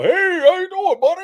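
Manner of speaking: high, bright mock-friendly greeting; cheery visitor greeting. Visitor greeting the brother at his door in a high cheery voice. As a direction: play the overly cheerful guy at the door.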